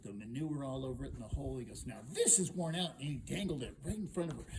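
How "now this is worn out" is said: THIS hit loud and higher; stress on THIS. Acted. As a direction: punch the word THIS. Hero contrasts what is worn out.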